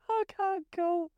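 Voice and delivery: high, slow words squeezed through real laughter; can hardly speak for laughing; helpless laughing. Real, not acted. Cracking up at friend's farmer accent, helpless laughing.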